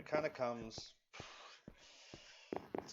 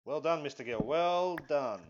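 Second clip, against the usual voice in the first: loud, raised mock praise of yourself; sarcastic self-congratulation; 4 semitones higher, 8 dB louder than usual. Real, not acted. Gamer scolding himself over his own build, sarcastic praise.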